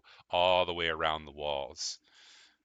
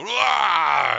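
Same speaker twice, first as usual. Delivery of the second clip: loud growling shout; growling roar; 12 semitones higher, 13 dB louder than usual. Acted. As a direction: roar it out in greeting. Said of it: "make a weird growling noise at each other".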